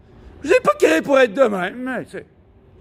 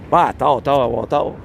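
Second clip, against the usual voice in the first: deep Thor voice; 11 semitones lower than usual. Pretend. Correcting how he says Thor.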